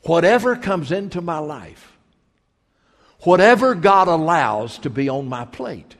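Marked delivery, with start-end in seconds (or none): none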